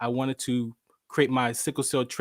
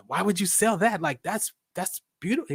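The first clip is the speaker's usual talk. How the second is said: higher-pitched retold disbelief; imitated baffled protest, pitched up; incredulous; 5 semitones higher than usual. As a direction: question it in mock astonishment. Incredulous at sale plan.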